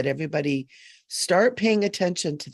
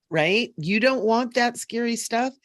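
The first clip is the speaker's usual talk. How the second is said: ordinary delivery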